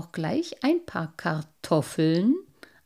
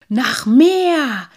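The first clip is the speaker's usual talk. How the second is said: higher, louder, mouth full; a lightly voiced sprite talking while chewing; munching; 6 semitones higher, 10 dB louder than usual. A narrator's reading. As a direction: say it smacking your lips over the food. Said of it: "sagte der kleine Wassermann schmatzend".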